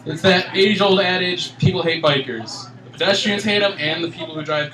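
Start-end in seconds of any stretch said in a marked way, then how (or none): none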